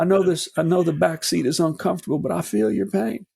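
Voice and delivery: high-pitched politician impression; Bill Clinton. Podcaster playing the former president consoling her, Clinton impression. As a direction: lay on a high, sympathetic politician voice. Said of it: "My Bill Clinton sound".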